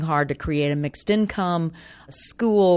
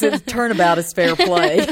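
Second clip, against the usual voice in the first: laughing; 9 semitones higher, 4 dB louder than usual. Real, not acted. Teasing about Southern accents.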